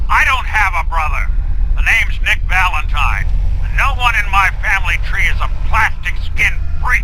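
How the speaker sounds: high, tense and defensive; hostile denial. Acted. Nick, in the memory, denying his brother angrily. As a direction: push him away with contempt.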